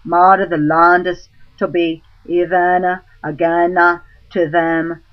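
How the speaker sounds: ordinary delivery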